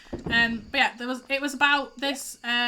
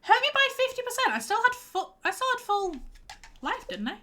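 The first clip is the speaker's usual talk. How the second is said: much higher, outraged at the damage; indignant disbelief; 11 semitones higher than usual. Real, not acted. Player hit by her teammate Joe, high outraged disbelief. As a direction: squeak it in disbelief.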